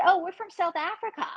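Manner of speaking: high-pitched imitation South African accent. Fans telling her where they're from, imitated South African accent. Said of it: "talking in this accent that I've never heard".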